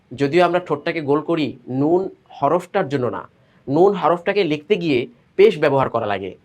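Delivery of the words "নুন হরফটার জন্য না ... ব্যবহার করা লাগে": ordinary talk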